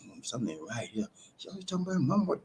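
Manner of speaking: thinking it over to himself, hushed; quiet musing. Retold or imitated. Listener quietly doubting a woman who always talks about her mama. His own private suspicion retold.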